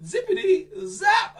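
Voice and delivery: loud, high magic word; excited shout. Acted. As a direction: shout the spell triumphantly. Magic spell climax.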